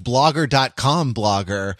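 ordinary talk

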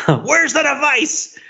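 normal talking voice

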